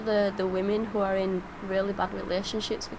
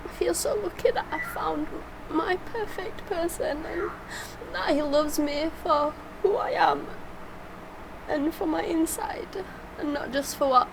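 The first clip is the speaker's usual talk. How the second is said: choked-up and tearful, voice climbing high; fighting back tears while thanking her husband; breaking into tearful little laughs; emotional; 5 semitones higher than usual. Real, not acted. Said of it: "no wonder she becomes so emotional".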